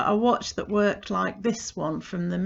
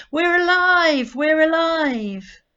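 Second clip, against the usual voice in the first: much higher, louder, slow, in a different voice; cheerful watch hands; 9 semitones higher, 8 dB louder, slower than usual. Acted. Watch hands waving at the child, high and loud. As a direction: sing out like waving little hands.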